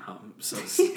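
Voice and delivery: squeaky high laughing. Pretend. Laughing squeal of mock fear.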